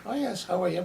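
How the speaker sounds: lightly imitating a polite, guarded hello; reserved Irish greeting. Contrasting the Irish with demonstrative peoples, a mild reserved greeting. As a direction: keep it polite and closed off, hands tight. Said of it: "So the Irish".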